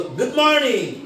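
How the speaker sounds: slow "গুড morning" swung high on "morning". Shown as a demonstration. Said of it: "এবার up হবে কোথায়?".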